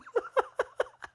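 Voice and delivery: airy, high giggle; unvoiced giggle at the joke; breathy high laugh. Real, not acted. Laughing at own story.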